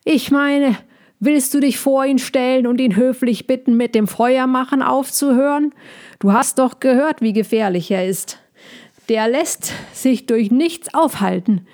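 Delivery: high and insistent; agitated hedgehog. Acted. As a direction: pester your friend with worried objections. Voices the agitated hedgehog passenger.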